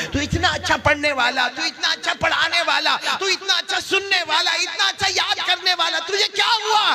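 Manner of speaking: high lament climbing phrase by phrase; dismayed teacher. Acted. Teacher asking dead student what befell him, dismayed. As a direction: build to a high, stretched outcry.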